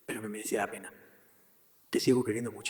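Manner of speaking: hushed, intimate near-whisper; tender, loving. Retold or imitated. Christ speaking from the cross, tender and hushed. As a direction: let it sink to a very soft, low avowal.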